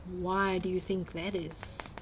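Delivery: ordinary delivery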